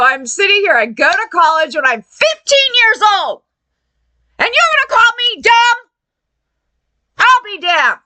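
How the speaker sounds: high-pitched, worked-up indignation; indignant rant. Retold or imitated. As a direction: let the outrage climb and tumble out. Indignant rant at being called dumb.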